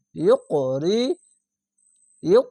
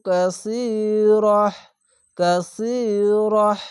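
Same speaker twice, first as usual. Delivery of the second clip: slow drawn reading; 3 semitones higher, 4 dB louder, much slower than usual. Shown as a demonstration. Teacher modelling stopping on কাসিরহ slowly. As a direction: draw out কাসিরহ and breathe the final হ. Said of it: "রহ এভাবে উচ্চারণ করবেন".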